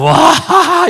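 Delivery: loud, high whoop of delight; gleeful cheer. Retold or imitated. The pastor cheering his own lucky tennis shot, loud. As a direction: cheer like you just won the point. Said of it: "좋아 죽어요".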